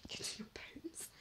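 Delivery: whispered as a spooky tease; mock spooky whisper. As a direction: breathe it out like a ghost story. Mock spooky echo of mum's words.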